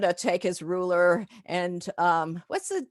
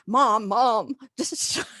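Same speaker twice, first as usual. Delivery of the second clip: embarrassed daughter; 4 semitones higher, 4 dB louder, slower than usual. Retold or imitated. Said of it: "the daughter's going".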